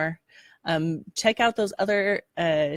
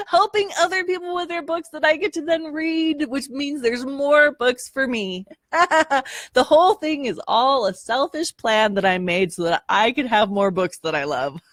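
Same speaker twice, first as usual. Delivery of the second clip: lightly voiced scheming villain; unhinged villain; 8 semitones higher than usual. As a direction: reveal a selfish plan gleefully. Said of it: "That's my evil laugh".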